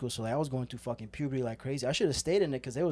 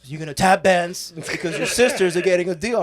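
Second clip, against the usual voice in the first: louder, insistent mom laying down the law; lightly voiced bossy mother, rising in volume; imitated mom; 2 semitones higher, 8 dB louder than usual. Son mimics his mom ordering him into tap class, bossy. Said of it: "my mom, you know, Latinas are like".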